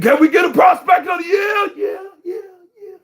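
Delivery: high, loud hype shout; rally the crowd like a proud father; imitating a hyped-up dad. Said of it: "You remember he said".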